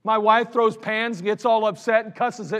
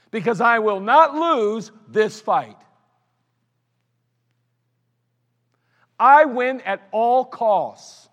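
stubborn vow; 3 semitones higher, 7 dB louder, much slower than usual. Acted. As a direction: spell out each word like you refuse to lose. Preacher voicing his stubborn arguing self.

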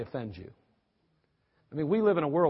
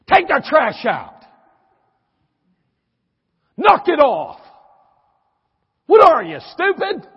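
furious shout, much higher and louder; angry yelling parent; 10 semitones higher, 15 dB louder, slower than usual. Acted. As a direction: bark orders at the kids like a fed-up parent.

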